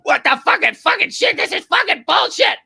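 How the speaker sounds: high, loud furious shouting; cussing up a storm. Retold or imitated. Friend cursing the Chicago weather in the street, loud high furious shouting. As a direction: rant and curse at the cold weather. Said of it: "Cussing up a storm in the streets".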